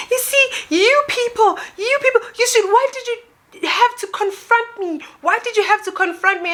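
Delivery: very high mock-wailing complaint; tearful woman impression. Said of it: "She starts crying".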